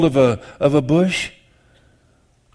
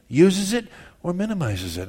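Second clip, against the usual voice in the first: ordinary talk, nothing marked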